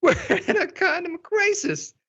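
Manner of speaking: very high, hammed-up drama queen. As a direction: squeal it like a theatrical diva. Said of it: "this is my drama queen voice".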